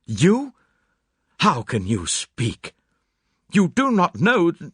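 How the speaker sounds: panicked outburst. Acted. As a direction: blurt it in panic then stop short. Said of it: "sprang up again".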